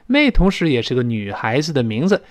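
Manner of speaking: ordinary talk, nothing marked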